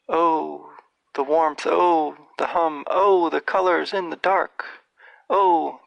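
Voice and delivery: slow, rapt poetry reading that swells on each oh; poem recitation. Acted. Reading Tolkien's Goblin Feet aloud to listeners, rapt recitation. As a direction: recite it like an enchanted spell, lingering on the images. Reads the poem's last stanza.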